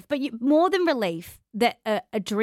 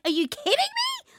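incredulous. Retold or imitated. New mum reacting to being asked about work, high incredulous squeak. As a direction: pitch shoots way up in disbelief. Retold outrage at churchgoer.

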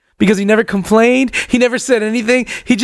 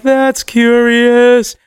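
very slow, higher, drawn-out musing; half-chanted dazed puzzlement; stoned slow motion; 4 semitones higher, 4 dB louder, much slower than usual. Retold or imitated. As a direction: stretch each syllable in wonder. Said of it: "when you're this high".

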